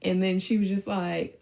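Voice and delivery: usual speaking voice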